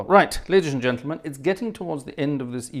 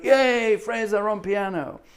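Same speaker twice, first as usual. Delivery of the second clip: much higher, loud cheering greeting; excited cheer; 9 semitones higher, 3 dB louder, slower than usual. Retold or imitated. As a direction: cheer his name out like an excited host. President cheers from stage.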